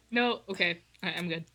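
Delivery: ordinary talk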